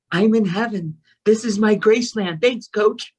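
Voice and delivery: high, giddy joy; elated. Retold or imitated. Retelling her arrival at the ride, elated.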